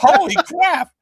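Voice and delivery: high thrilled exclamation; bursting with excitement; excited outburst. Retold or imitated. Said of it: "I'm jumping up and down".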